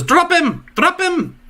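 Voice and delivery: high, sharp yelled command; guard shouting. Acted. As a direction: bark it at the intruder.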